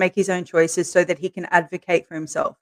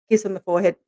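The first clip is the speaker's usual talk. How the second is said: usual speaking voice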